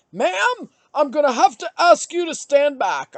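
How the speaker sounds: high, loud mock store-clerk voice; officious checkout worker. Checkout worker to shopper over the red line, loud officious order. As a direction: imitate a clerk calling a customer back.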